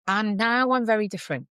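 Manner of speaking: ordinary talk, nothing marked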